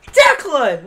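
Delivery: high loud call of Declan; family shouting name. Retold or imitated. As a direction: call him out loudly.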